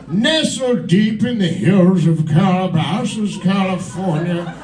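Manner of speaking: slow, awed nature-documentary narrator; David Attenborough. Acted. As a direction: narrate trash TV as solemn wildlife footage, drawing out key words. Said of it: "if David Attenborough was narrating the Kardashians".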